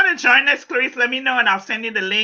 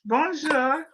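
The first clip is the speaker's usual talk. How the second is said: French; 5 semitones higher, 7 dB quieter than usual. Shown as a demonstration. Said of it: "I have to tip my head when I speak French".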